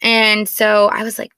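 ordinary talk

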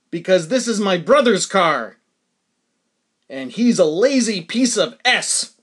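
loud, emphatic retold comeback; emphatic retort. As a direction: fire back at the critic, loudly. Retold snappy answer to a stranger.

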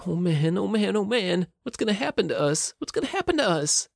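rising high, frantic and afraid; panicked. A narrator's reading. Gruff panics, captured.

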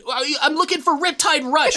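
high, laughing imitation of a clueless kid. Intruder kid explains himself to homeowner, high laughing kid voice.

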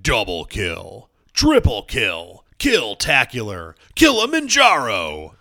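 slow, booming announcer callouts; Halo announcer voice. Retold or imitated. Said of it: "So people want me to do the stuff".

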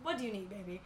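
soft, sweet mock niceness; nicest way possible. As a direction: coo it like a fake-nice bartender. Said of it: "in the nicest way possible".

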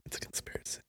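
spooky conspiracy whisper. Pretend. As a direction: whisper it like a secret.

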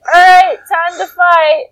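loud, high rallying shout; mock battle call. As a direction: call out like a cartoon hero. Acts Gohan returning to battle.

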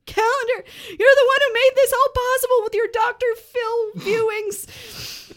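hammed-up sobbing gratitude; gushing. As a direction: gush with overblown gratitude. Gushing thanks to Calendar.

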